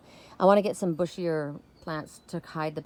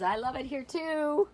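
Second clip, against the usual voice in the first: cat voice; 5 semitones higher than usual. Pretend. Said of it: "He says".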